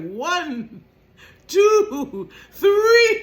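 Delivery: rising to a high loud laughing count; cartoon countdown. Cartoon character counting before sinking, rising high and loud. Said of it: "like they do on the cartoons".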